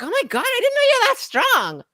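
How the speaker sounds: put-on high, breathless girly gasp; girlfriend voice. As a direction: squeal it like an amazed girlfriend. Imitating girlfriend's amazed reaction.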